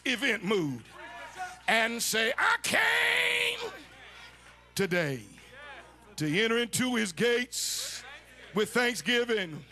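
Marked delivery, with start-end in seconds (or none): 2.4-9.6 chanted sermon climax with a sob in the voice; whooping preaching. Acted.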